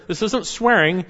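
ordinary talk, nothing marked

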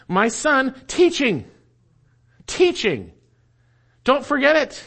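"teaching" set apart by pauses, pitched high; emphatic stress. Shown as a demonstration. Preacher showing Hebrew word order, emphatic stress on "teaching". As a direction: punch "teaching" high, then repeat it on its own. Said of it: "it is emphatic".

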